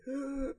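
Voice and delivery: a sharp, high, quiet gasp of shock; retold gasp. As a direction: catch your breath at the sight. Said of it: "y dije".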